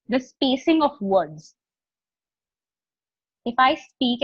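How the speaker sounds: long silent pauses between "sentence", "same", "meet"; pausing after phrases. Shown as a demonstration. Trainer showing how to pause after every sentence, long deliberate pauses. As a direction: stop for seconds after "sentence", "same" and "meet" before going on. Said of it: "Like, I was pausing between two".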